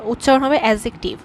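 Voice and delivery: normal talking voice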